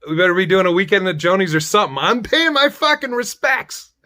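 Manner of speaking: hammed-up demand to pay respects; mock outrage. To the caller with the news, mock outraged yelling. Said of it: "I'd be like".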